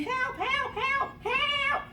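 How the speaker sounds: high-pitched mock cry for help; retold screaming for help. Storyteller stuck under van calls bystanders, high mock scream. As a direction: call out high, without full volume. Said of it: "So I started screaming".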